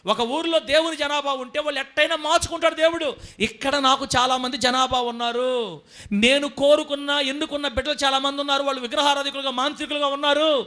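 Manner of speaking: high, loud preaching; fervent preaching. Acted. Preacher retelling God's promise to the church. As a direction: keep the fire up.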